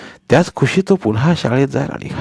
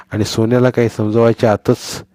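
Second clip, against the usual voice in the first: usual speaking voice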